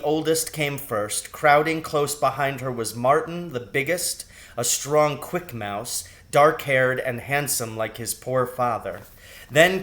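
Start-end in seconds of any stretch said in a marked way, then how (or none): none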